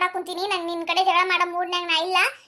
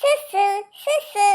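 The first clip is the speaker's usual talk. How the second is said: sing-song taunt; 3 dB louder, slower than usual. Acted. Boy teases girl with nickname.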